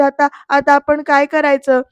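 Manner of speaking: ordinary delivery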